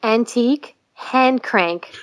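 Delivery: usual speaking voice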